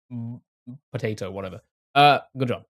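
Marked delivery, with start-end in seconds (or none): none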